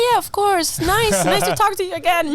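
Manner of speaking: high, over-friendly phone voice; hammed-up phone politeness; fake recognition. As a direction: bluff cheerily, pitch way up. Bluffs cheerfully to unknown caller.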